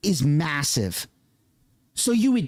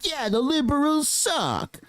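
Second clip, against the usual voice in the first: mock politician; 7 semitones higher than usual. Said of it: "they're like".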